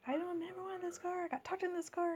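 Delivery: high-pitched mock yell without the volume; retold yelling. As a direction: act out complaining at your husband, high and contained. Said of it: "I just yelling at Dave".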